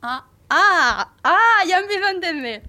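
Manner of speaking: drawn-out ahs swelling high and loud; dawning realisation. Retold or imitated. Employee finally understands who came, dawning realisation. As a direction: let each 'ah' grow as it clicks. The penny drops in retelling.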